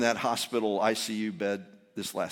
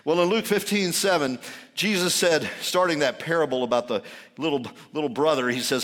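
usual speaking voice

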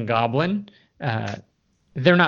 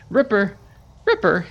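high, loud call to a pet wolf; calling to his wolf; 8 semitones higher, 6 dB louder than usual. A narrator's reading. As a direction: sing out the wolf's name, fond and loud. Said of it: "he says".

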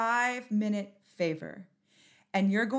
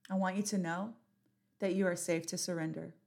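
ordinary talk

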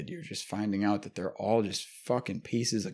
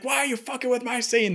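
higher-pitched mock tirade; retold actor snapping at the crew, higher-pitched mock anger; imitated angry rant. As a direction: act out the star tearing into the crew. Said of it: "went fucking off on this guy".

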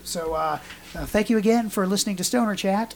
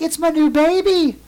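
higher, louder proud grandmother voice; doting grandmother; 11 semitones higher, 6 dB louder than usual. Retold or imitated. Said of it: "And she's like".

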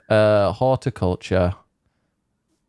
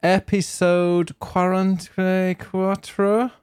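drawn-out, high announcer welcome; showy host intro; 9 semitones higher, much slower than usual. Acted. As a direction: sing out the show opening. Podcast host's sung-out intro.

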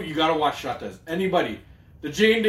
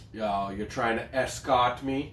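put-on American accent; 6 semitones lower, 5 dB quieter, slower than usual. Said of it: "he uses American accent".

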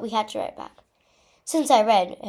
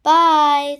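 loud, high, drawn-out farewell; cried out; 6 semitones higher, 13 dB louder than usual. A narrator's reading. Friends seeing the girl off, crying out. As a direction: cry out a goodbye. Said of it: "they all cried".

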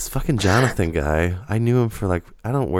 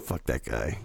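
muttered dismissal; 8 semitones lower, 10 dB quieter than usual. Pretend. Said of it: "I just".

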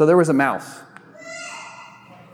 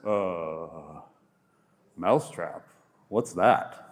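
clueless cow; 9 semitones lower, 5 dB quieter, much slower than usual. Acted. Cow answers dimly.

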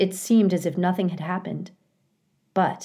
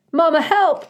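high, loud cry for help; frightened cry; 11 semitones higher, 7 dB louder than usual. A narrator's reading. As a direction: shout out like a child being snatched. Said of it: "cried Bright Bill".

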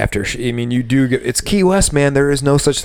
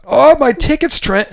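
higher, slower put-on server voice; mock server; 12 semitones higher, slower than usual. Bartender mocking a server handing off a ticket, higher slower voice. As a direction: say it like a clueless coworker.

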